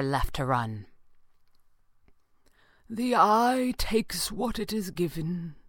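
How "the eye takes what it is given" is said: slow, resigned lament; sorrowful, drawn-out character voice; sad. A narrator's reading. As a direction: say it slowly with mournful regret. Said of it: "Falcone said sadly".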